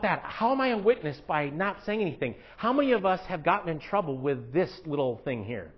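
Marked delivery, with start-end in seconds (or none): none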